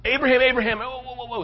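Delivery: high, hurried intervention; acted urgent high-pitched interruption; urgent angel calling out. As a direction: call out to halt someone just in time. Angel urgently halts Abraham's knife.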